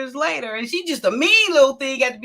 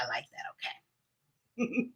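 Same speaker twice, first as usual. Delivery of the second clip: whispering it to herself; breathy, hushed approval; 4 semitones lower, 15 dB quieter, slower than usual. Retold or imitated. Mother whispers her approval of the baby's name, hushed.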